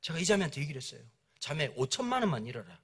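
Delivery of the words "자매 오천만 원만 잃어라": low, soft pastoral appeal; retold gentle advice, kept low and soft; quiet counsel. Pastor counselling a scammed church member, quiet counsel.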